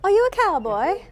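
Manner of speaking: put-on high, wide-eyed British child's question; imitated British boy. Little boy at Disney asks a Southerner, imitated British accent. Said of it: "Just like that".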